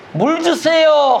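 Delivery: high, slow begging; mock plea. Preacher acting Hagar begging for water, high and slow. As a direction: stretch 물 주세요 out like a parched plea.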